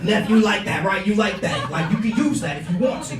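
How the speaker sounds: high, loud, worked-up uncle voice; hysterical uncle impression. Uncle pushing his joke on nephew, hysterical uncle voice. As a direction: play the uncle tickled by his own joke. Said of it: "But he's laughing".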